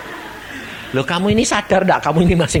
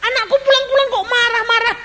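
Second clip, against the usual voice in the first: complaining to God; 3 dB louder than usual. Retold or imitated. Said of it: "Dia bilang".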